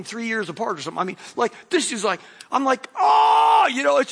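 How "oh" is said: pained shout. Retold or imitated. Pain of second poke.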